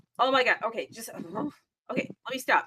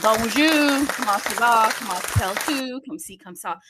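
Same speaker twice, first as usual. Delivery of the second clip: mock French greeting; 8 dB louder than usual. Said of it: "That's all I know".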